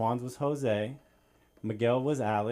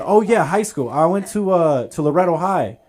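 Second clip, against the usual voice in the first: higher, louder cocky bluff; bluffing friend; 6 semitones higher, 10 dB louder than usual. Retold or imitated. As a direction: cover the mistake with bravado.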